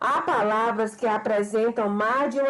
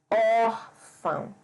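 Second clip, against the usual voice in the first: "órfão" very slow, open ÓR stretched; teacher's slow "órfão", weight on ÓR; stress on the accented syllable; much slower than usual. Shown as a demonstration. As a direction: draw out the first syllable ÓR of "órfão". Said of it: "O acento agudo no O".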